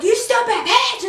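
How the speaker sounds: high-pitched re-enacted outburst; angry insult. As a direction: act out lashing out mid-fight. Acting out insult during the fight.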